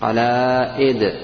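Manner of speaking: قلائد drawn out slowly with the hamza; slow teacher model; slow careful model. Shown as a demonstration. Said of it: "الحرف الآن المستعمل".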